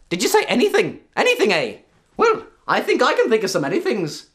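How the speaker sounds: high, loud mischievous leprechaun; a cheeky little sprite teasing her. Acted. Leprechaun voiced in told story.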